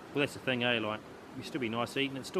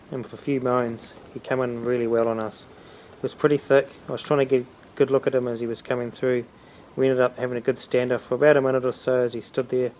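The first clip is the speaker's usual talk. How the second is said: voice-over narration; 14 dB louder than usual. A narrator's reading.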